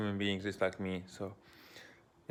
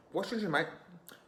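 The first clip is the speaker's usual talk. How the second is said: put-on high British accent, last word breathed out; clipped London mate, pitched up; 8 semitones higher than usual. Repeating the UK line in a British accent. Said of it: "I was speaking like this in the accent".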